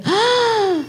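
high, drawn-out "ah" of awe; amazed gasp. Retold or imitated. Woman in the congregation reacting to the pastor, amazed gasp. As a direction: gasp in astonishment, re-enacting it. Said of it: "y yo dije".

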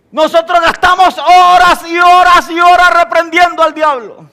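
high, loud, drawn-out preacher's emphasis; preaching emphatically. Real, not acted. Preacher rousing his congregation, loud and drawn out. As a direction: hammer home the hours and hours. Preacher stresses hours spent rebuking.

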